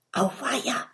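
very soft worried whisper. A narrator's reading. Dragon friend dismayed at who is coming, whispering. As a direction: whisper it in alarm, barely audible. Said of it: "flüstert Oskar".

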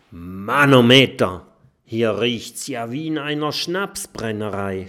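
loud, amazed cry at the smell. A narrator's reading. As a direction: marvel out loud at the stench. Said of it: "staunt Mathilda".

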